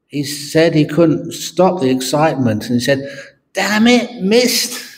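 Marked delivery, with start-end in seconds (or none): 3.5-4.9 higher, exasperated cry of frustration; frustrated curse. Acted. The Pope curses his missed putt, higher and exasperated. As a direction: blurt it out, annoyed at missing. Pope swears a second time.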